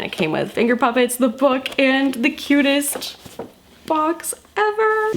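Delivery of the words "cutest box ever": gushing. Real, not acted. Gushing over cute box.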